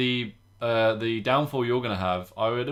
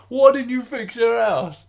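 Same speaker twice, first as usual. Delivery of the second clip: much higher, louder Cockney TV host voice; Cockney accent; 11 semitones higher, 8 dB louder, slower than usual. Retold or imitated. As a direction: greet the viewers in a big Cockney accent. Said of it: "And then you go".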